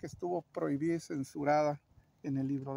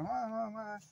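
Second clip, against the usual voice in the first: mock child's cry; 4 semitones higher, 5 dB quieter, faster than usual. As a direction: lightly imitate a boy crying for mom. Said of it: "el niño grita". (grito fingido de niño, agudo y contenido)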